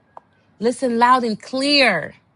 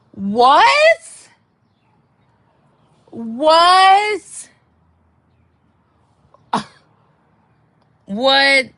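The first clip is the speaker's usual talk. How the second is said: high, loud disbelieving what; incredulous what; 4 semitones higher, 6 dB louder, much slower than usual. Real, not acted. Reacting to his excuse with loud disbelief. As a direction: shout your disbelief. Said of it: "disbelief at his excuse".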